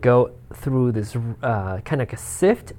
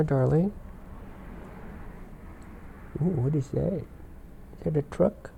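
soft cooing pet voice; talking to a cat; 3 semitones higher, 8 dB quieter than usual. Real, not acted. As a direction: murmur to the cat.

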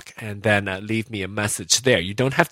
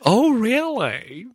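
mock surprise; 12 semitones higher, much slower than usual. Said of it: "Oh, no, that was me".

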